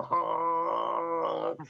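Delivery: drawn-out mock car horn; voicing the car horn blaring; imitated horn honk. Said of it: "the horn going".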